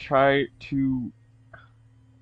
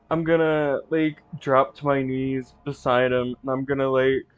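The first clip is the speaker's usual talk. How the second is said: normal talking voice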